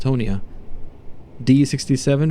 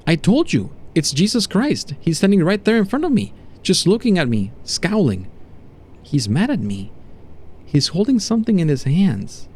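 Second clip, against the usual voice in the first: higher, insistent and frightened; shaken test subject; 5 semitones higher than usual. Acted. Subject to doctor about figure standing before him, shaken. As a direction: insist on it, voice raised in pitch.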